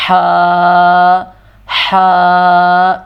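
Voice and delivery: ح breathed from the back of the throat; ہا with a strong throat friction; throaty ha. Shown as a demonstration. Teacher demonstrating the throat sound ح. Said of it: "گلے کے آخری حصے سے نکل رہی ہوتی ہے".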